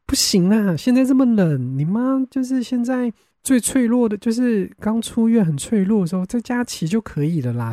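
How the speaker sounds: lightly voiced anxious doctor, urging caution; alarmed doctor. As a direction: play the doctor shocked and warning. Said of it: "连医生都冒汗说".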